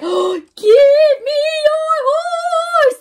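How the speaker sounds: high, slow sung ghost wail; eerie high sung cry for a horse; ghostly demand. Acted. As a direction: moan it like a haunting spirit. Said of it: "That sounds like the horseless horseman".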